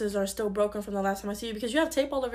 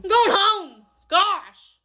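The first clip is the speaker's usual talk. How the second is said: loud, high retort; raised-voice exasperation; exasperated yell; 8 semitones higher, 7 dB louder, much slower than usual. Acted. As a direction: shout back in a huff. Yelling back when sent away.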